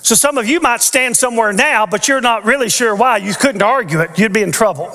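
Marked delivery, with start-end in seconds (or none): none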